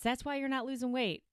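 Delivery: ordinary delivery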